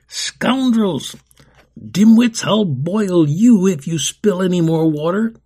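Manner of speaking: loud, high scolding yell; angry shout. Acted. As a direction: tear into the clumsy orphans.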